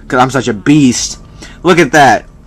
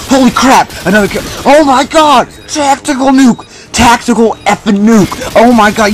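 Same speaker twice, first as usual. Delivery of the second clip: much higher, ecstatic victory shout; triumphant shouting; 8 semitones higher than usual. Real, not acted. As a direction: scream it like you just won. Gets the tactical nuke, celebrates.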